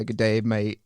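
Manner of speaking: ordinary talk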